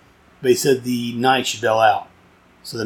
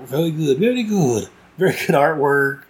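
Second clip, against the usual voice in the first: playful singsong praise. Pretend. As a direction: let "very good" leap up playfully. Playful repeat while praising the artwork.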